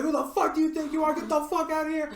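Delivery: high-pitched mock yell, words rushed; hammed-up furious manager telling him off; retold angry yell. Manager getting in the rude customer's face, a mock high yell.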